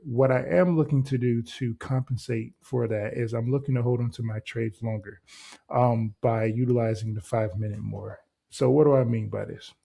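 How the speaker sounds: ordinary delivery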